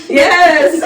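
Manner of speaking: high, loud yes through laughter; emphatic, laughing confirmation; laughing exclamation. Real, not acted. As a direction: burst out laughing as you confirm it. Laughing confirmation of shocking story.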